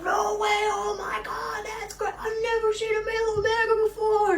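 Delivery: high excited bro voices. Retold or imitated. The bros marvelling at a male Omega, pitched high. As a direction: squeal it like amazed frat boys. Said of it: "They're like".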